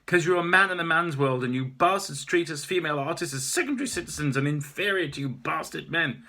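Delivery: furious artist tirade; angry rant. Acted. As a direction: berate him hotly. Maeve ranting at gallery owner.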